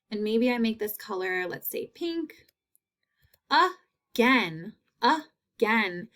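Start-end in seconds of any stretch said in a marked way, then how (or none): 3.5-6.1 again in two separate beats; split into syllables. Shown as a demonstration. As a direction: pause between a and gain in again. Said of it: "I hear short A's".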